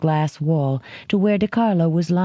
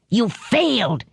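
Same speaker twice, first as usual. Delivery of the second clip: high shouted accusation; mild narrated shout; 5 semitones higher than usual. A narrator's reading. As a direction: yell it down at him. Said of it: "he shouted".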